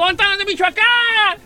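stage host rousing an audience; own crowd call. Shown as a demonstration. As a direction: call out clearly to the whole crowd. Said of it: "Yo digo".

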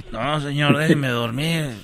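drowsy low mumble; half-asleep annoyed passenger; sleepy grumble. Acted.

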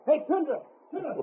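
eager high-pitched call; boy hailing his dog. Acted.

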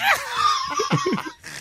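high shrieking laugh; burst of laughter. Real, not acted.

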